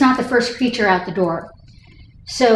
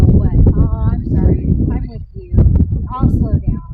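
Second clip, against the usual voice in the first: voicing the dog. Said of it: "and he'll go".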